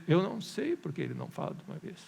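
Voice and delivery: unbothered, toned-down reply; calm answer to an impatient question; matter-of-fact admission. Shown as a demonstration. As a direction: concede it simply, without pushing. Preacher's own answer, same words.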